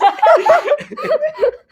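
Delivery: loud, high shriek of laughter; piercing cackle; helpless laughter. Real, not acted. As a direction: burst out laughing, high and long.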